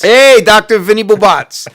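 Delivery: loud, high comic holler; hammed-up loud catchphrase; catchphrase shout. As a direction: belt it out like a big greeting.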